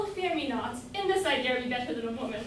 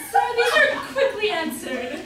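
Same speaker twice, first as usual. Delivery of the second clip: laughing reply; 6 semitones higher, 9 dB louder than usual. Acted. Luce laughs off the suitor.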